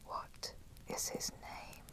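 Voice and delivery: hushed, drawn-out whispered challenge; slow whisper. Acted. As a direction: drop to a slow, very soft whisper. Vampire quietly challenges captive hunter.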